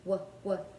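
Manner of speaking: W said as the short sound "wuh", twice; isolated first sound. Shown as a demonstration. Teacher isolating the first sound of walks, short repeated "wuh". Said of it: "The first sound in the word walks is w".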